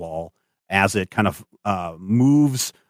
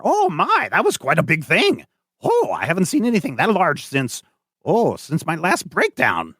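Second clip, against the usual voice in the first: put-on high, fluttery old gentleman; eccentric Doctor West; 7 semitones higher than usual. GM as Doctor West peering over the canal edge, high and dithery.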